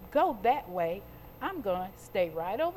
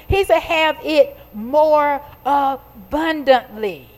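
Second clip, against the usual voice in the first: loud, slow, drawn-out preacher's proclamation; full-force preaching; 14 dB louder, slower than usual. Real, not acted. Preacher proclaiming good news to the congregation, loud preaching. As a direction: preach it loud, stretching the key words.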